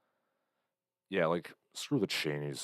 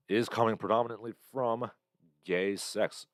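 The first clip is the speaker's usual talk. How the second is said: ordinary talk, nothing marked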